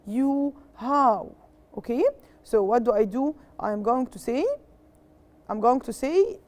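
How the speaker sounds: normal talking voice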